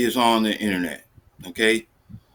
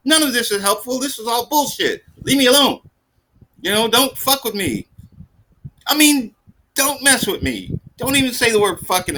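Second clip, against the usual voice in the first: high, frantic inner tirade; panicked rant; 10 semitones higher than usual. Retold or imitated. Embarrassed man silently ranting at the sex shop clerk, high and frantic. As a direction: spiral into high-pitched panic.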